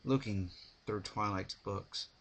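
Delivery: plain reading voice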